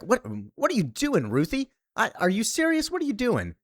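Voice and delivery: high, startled questioning; shocked disbelief. Retold or imitated. Supergirl catching Ruthie at the ship, high shocked questions. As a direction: raise the pitch in stunned disbelief.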